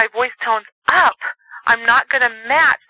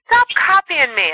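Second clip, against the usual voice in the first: high, slow teasing copy; mocking parrot; 8 semitones higher, slower than usual. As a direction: drag it out to mock her. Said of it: "parroting one of your siblings".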